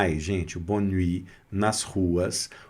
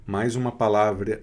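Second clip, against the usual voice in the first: ordinary talk, nothing marked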